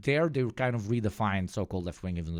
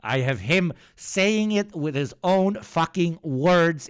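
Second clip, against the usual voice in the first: louder, higher and slower, hammering every word; fed up, slams down the proof; heated outburst; 9 semitones higher, 8 dB louder, slower than usual. Real, not acted. Streamer ranting at chat about his sources, furious and loud.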